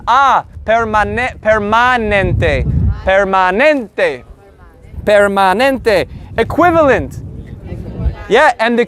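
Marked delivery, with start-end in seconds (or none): 1.4-6.1 permanente drawn out slowly, open a like at the dentist; slow teacher model of permanente; slow Spanish model. Shown as a demonstration. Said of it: "the A is like at the dentist".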